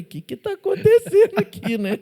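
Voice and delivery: high, laughing mock alarm; a high-pitched retold startled question. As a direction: ask it like you just got a fright. Retells his startled question.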